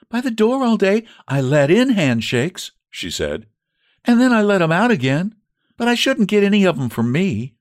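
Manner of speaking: maid's voice. Acted. Narrator voices Elpha the maid.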